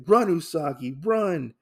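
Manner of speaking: acted urgent warning; urgent call. Urgent order to flee, retold.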